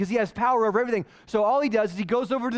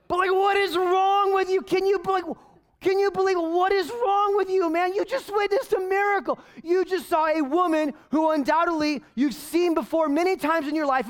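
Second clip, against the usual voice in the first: exasperated outburst; 7 semitones higher than usual. Acted. Preacher rails at the ruler.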